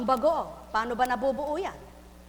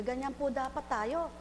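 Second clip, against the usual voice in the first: usual speaking voice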